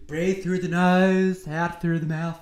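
slow, drawn-out breathing instruction; loud and slow, leading a breath; mock breathing coach.

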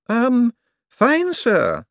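high, startled um; flustered secretary. Acted. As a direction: caught off guard and squeaking.

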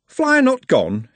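high, slow questioning driver; inquiring driver. A narrator's reading. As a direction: ask slowly, surprised. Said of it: "he inquired".

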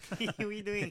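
high, very loud parroting; mocking echo. Listener parrots the texter's follow-up question, loud high mockery. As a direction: throw it back as a loud, high-pitched tease.

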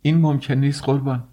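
high-pitched dismayed protest; aghast, strained high objection; distraught. A narrator's reading. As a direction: refuse in near-panic, voice pushed up. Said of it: "نزدیک بود دیوانه شود".

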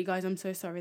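normal talking voice

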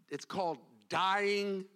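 high, loud impassioned preaching; preaching at full force. Acted. Pastor driving the point home to the congregation, full-force preaching. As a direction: build it to a fervent pulpit climax.

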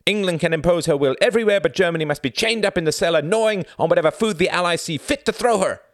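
high, loud tirade of scorn; suddenly scornful. A narrator's reading. Klaus rails at Tom about Allied hypocrisy, scornful. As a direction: build into a rapid, indignant rant. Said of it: "his voice scornful all of a sudden".

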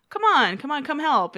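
high, loud coaxing; imitated insistence. Host voices Ted pressing Janice to help, insistent coaxing. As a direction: urge them along, mock insistent. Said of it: "insistent about it".

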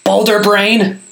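high, loud exasperated outburst; blurted insult. A narrator's reading. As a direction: snap it out at the golem. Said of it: "Gaz blurted".

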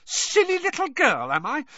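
put-on "Silly little girl" thrown back shrill and very high; furious mocking echo. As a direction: mimic the insult back in outrage. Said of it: "shaking with rage".

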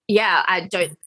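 ordinary talk

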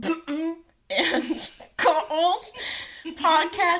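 names bleeped out, laughing; suddenly muffled censor. Acted. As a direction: garble the name like a censor. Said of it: "I had to censor their names".

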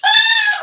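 sudden loud, high shriek of laughter. Real, not acted. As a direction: let a big laugh burst out. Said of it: "I'm just laughing at Adrian".

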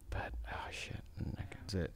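barely audible whisper; real whispered aside, voice nearly gone; muttering to self. Real, not acted. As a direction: whisper it to yourself while searching your memory. Trying to recall the title.

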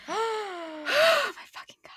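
gasp, then a high, drawn-out swoon; overwhelmed gushing. Real, not acted. Overcome recalling a beloved book.

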